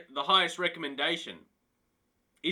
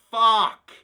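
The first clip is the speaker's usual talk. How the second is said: a loud burst of a curse; loud swear; 3 semitones higher, 10 dB louder than usual. Real, not acted. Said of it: "Sorry, that had to be a swear".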